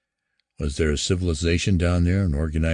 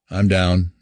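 ordinary delivery

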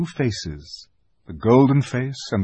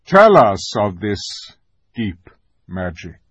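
measured, grave command; lightly voiced solemn lion; 8 dB louder than usual. A narrator's reading. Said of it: "answered Aslan gravely".

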